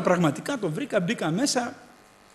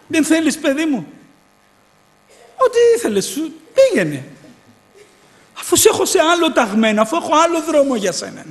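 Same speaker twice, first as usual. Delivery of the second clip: put-on higher, louder voice of God rebuking his child; God's voice, higher and louder, chiding the speaker; 9 semitones higher, 7 dB louder than usual.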